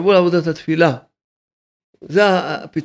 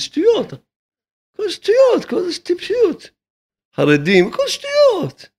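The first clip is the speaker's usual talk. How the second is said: high mocking voice; 13 semitones higher than usual. Rabbi scoffing at those arguing over two hundred shekels, high and mocking. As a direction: jump way up into a high, incredulous mocking pitch. Rabbi dismissing religious divisions.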